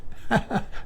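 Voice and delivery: breathy unvoiced chuckle; amused little chuckle; soft laugh. Real, not acted. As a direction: laugh quietly to yourself. Laughs at own joke.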